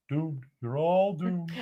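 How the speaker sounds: slow ominous raspy drawl; doom it out like old Ralph; Ralph impression. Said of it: "as old Ralph would say".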